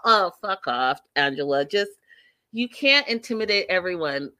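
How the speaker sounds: normal talking voice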